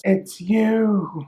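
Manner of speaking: corpse voice. Acted. Corpse points and accuses.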